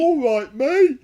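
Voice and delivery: very high, loud hammed-up British "All right, mate"; mock British bloke. Teasing his wife about her imitation, hammed-up British bloke. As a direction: overdo a cheery cockney greeting. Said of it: "Make me sound like Bernard Bresslaw".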